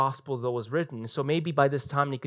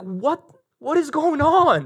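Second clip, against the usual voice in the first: mock disbelief; 10 semitones higher, slower than usual. Council reacts in disbelief.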